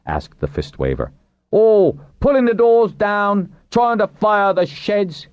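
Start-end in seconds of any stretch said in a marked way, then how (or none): 1.5-5.3 slow, high crowd outcry; indignant accusation. A narrator's reading. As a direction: accuse them, stretching the words in a raised voice. Crowd member accuses captives.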